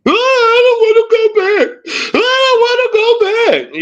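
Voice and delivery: high falsetto mock wail; mock crying. Mocking troll crying to the channel, high fake sob. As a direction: whine it like a crybaby being mocked. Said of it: "we laughing at y'all screaming, hollering, yelling, and crying".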